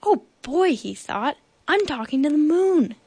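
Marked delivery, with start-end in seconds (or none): 0.0-0.8 high, thrilled exclamation; excited bear. A narrator's reading. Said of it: "Bear got very excited". 1.7-2.9 high, eager storybook voice; excited bear. A narrator's reading. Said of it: "Bear got very excited".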